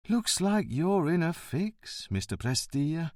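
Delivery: slow, mock-kindly fatherly concern; a hint of a patient father; compassionate, fatherly. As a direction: talk to him like a sorry father. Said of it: "in a compassionate, almost fatherly tone".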